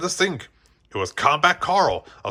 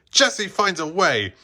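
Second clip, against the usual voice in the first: triumphant line; 7 semitones higher than usual. A narrator's reading. Jessie triumphs.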